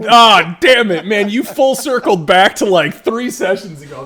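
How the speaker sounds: loud, high exasperated rant; excited outburst. Real, not acted.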